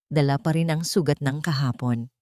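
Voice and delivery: ordinary delivery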